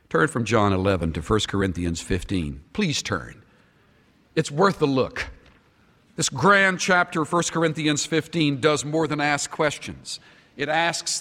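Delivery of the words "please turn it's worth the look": ordinary talk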